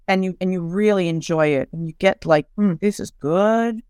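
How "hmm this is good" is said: slow, relishing mmm; savouring the food. Pretend. Mourner at the holiday table enjoying the food, slow relishing. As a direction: linger over it like a tasty bite.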